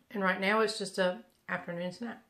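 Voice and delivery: normal talking voice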